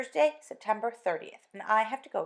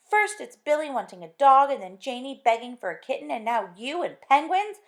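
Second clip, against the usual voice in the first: louder, higher nagging wife; exasperated scolding; 4 semitones higher, 10 dB louder than usual. Acted. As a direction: scold him, fed up with pets. Wife scolds about pets.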